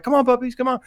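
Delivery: high coaxing dog call; calling puppies. Retold or imitated. Storyteller calling two dogs along, high coaxing voice.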